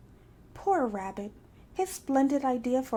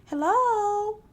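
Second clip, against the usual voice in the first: calling out; 10 semitones higher, 6 dB louder than usual. A narrator's reading. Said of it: "called Roo".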